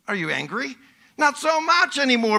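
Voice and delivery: ordinary talk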